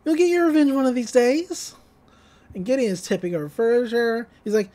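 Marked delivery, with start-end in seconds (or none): 0.1-1.7 lightly higher, folksy dad voice; a hint of a soothing Southern dad; Bud Gleeful's voice. A father comforts his son, in a lightly higher folksy voice. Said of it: "And Bud's like".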